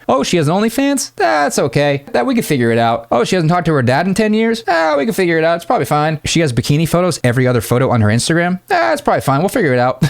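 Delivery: high, breezy mock woman shrugging everything off; mock girly voice. Mocking how a woman dismisses his red flags, high and breezy. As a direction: play a carefree girl waving off every red flag.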